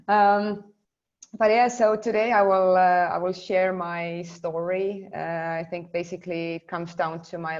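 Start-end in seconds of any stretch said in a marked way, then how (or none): none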